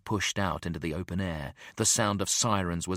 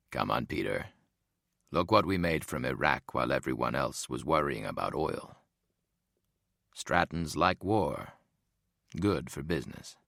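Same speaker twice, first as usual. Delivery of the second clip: calm bald boss; 5 semitones lower than usual. A narrator's reading. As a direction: reassure him, cool and smug. Boss reassures coolly.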